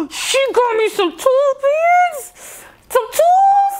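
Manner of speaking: high, slow, teary squeal of joy; nearly crying with happiness; overjoyed cry. Real, not acted. Overwhelmed gift unboxer, high teary squeal.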